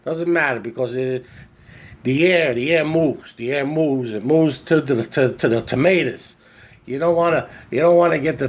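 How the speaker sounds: imitating uncle. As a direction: play the stubborn uncle, insisting over and over. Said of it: "He's like".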